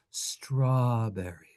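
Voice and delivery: slow, lovingly stretched strawberry; savoring strawberry. Shown as a demonstration. Teacher savors the English word strawberry, slowly. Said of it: "beautiful luscious word".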